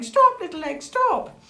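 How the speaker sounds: high, loud acted yell; storybook yell. A narrator's reading. Sid yelling as he runs after the egg, high acted yell. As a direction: call urgently after something escaping.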